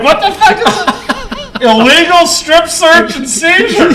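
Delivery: incredulous reading. Retold or imitated. Reading a surprising lawsuit letter.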